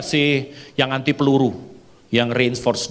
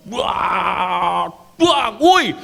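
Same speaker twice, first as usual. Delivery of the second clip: long high wail into a sharp whoop; excited yell; 8 semitones higher, much slower than usual. Retold or imitated. Acts out shouting during landing prayer. (teriakan heboh melengking)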